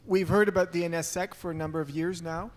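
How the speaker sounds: usual speaking voice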